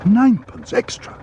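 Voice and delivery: high-pitched indignant outburst; high, outraged young bear; hot exclamation. A narrator's reading. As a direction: exclaim it hotly, affronted. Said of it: "exclaimed Paddington hotly".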